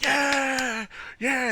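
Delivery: high, drawn-out mock cheer; mock celebration. Imitating defendants celebrating a not guilty verdict, elated. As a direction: cheer too early in a high voice. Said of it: "they was like".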